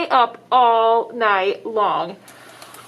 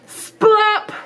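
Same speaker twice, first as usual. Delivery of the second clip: sound effect; 9 semitones higher than usual. Acted. Sound word from the book.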